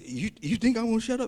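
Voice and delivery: high-pitched comeback to the crowd; defiant retort. Acted. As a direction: challenge those who tried to silence you. Acting blind man defying the crowd.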